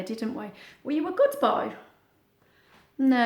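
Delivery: ordinary delivery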